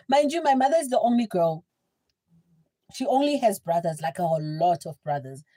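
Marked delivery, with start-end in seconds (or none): none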